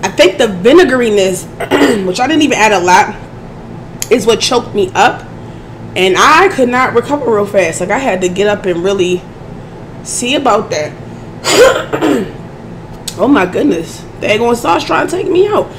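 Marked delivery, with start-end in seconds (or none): 1.5-2.0 throat clearing. Real, not acted. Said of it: "I'm sorry I keep doing that". 12.4-13.1 throat clearing. Real, not acted. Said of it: "I'm sorry I keep doing that".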